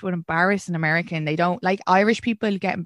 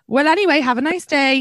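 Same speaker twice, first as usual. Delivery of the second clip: higher, louder, cheerfully dodging it; breezy American lad; 6 semitones higher, 5 dB louder than usual. Retold or imitated. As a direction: brush it off like a carefree American guy. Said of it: "he would be like".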